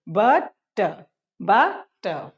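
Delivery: "बटर" broken into but, pause, ter; split syllables. Shown as a demonstration. Teacher drilling two-syllable words, "बटर" split as but - ter. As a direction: say each "बटर" in two separate beats. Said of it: "दो syllable से बने हैं".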